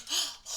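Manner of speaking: a sharp, offended little gasp; mock gasp. Staff member gasps at the customer's complaint, mock offended gasp. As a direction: gasp as if shocked by the accusation.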